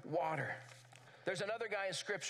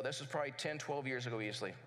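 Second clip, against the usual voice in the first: normal talking voice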